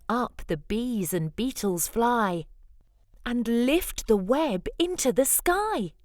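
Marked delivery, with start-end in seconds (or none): none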